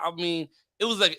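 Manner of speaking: ordinary delivery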